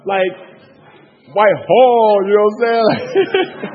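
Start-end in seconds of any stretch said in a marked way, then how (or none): 1.3-3.7 louder, higher, hometown name punched out; emphatic hometown shout. Retold or imitated. Friend declaring he is from Whitehall, loud playful emphasis. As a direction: belt the hometown name, then laugh it off.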